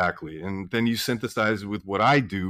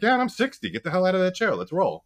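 pumped-up retort; 9 semitones higher than usual. Retold or imitated. Doctor to defeatist patient, high excited retort. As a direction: yell at them to get moving. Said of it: "And I'm like".

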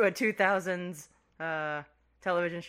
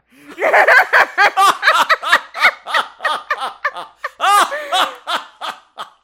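high squawking cartoon laugh; Scrooge McDuck laugh; 20 dB louder than usual. Real, not acted. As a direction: cackle like a cartoon duck. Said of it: "That laugh, that was so, like, Scrooge McDuck".

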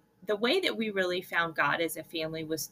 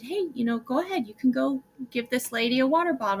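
normal talking voice

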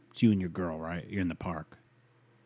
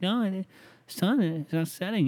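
put-on high, protesting girlfriend; 10 semitones higher than usual. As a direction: whine it high and stubborn. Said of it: "She's like".

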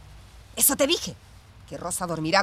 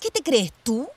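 loud, higher scornful challenge; angry challenge; 5 semitones higher, 5 dB louder than usual. Acted. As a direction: throw it at her, offended. Sisters quarrelling.

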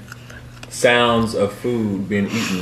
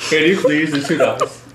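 speaking through real laughter; giggling while asking; laughing; 7 semitones higher, 4 dB louder than usual. Real, not acted. Laughing with students.

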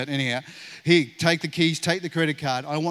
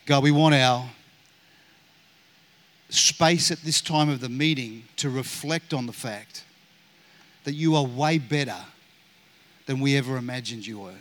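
closing prayer. Acted. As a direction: pray it gently, lifting the room to God.

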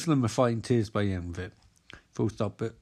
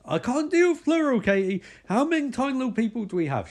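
panicked Mike; 10 semitones higher than usual. Retold or imitated. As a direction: freak out about the numbers, voice up.

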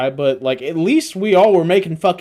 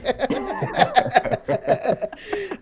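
high, helpless giggling; laughter; 6 dB quieter than usual. Real, not acted. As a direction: laugh softly and long at your own bit. Cracking up at own impression.

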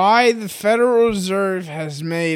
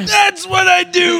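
high shouted sales pitch; hammed-up pitchman; 10 semitones higher than usual. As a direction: yell it like a wild TV pitchman.

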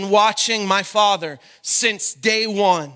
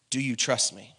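very low, soft question; hushed, searching voice; gentle retold question; 12 semitones lower, 10 dB quieter than usual. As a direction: ask it gravely, almost under the breath. Retells God's third asking.

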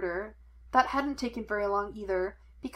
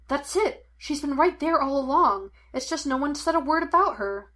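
high, louder excited burst; raised, eager discovery; sudden realisation; 5 semitones higher, 5 dB louder than usual. A narrator's reading. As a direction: blurt out the breakthrough. Sudden realisation in a detective story.

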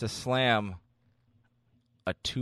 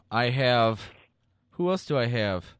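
ordinary talk

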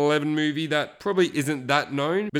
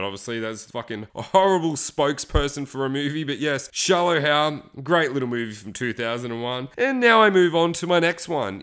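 ordinary delivery

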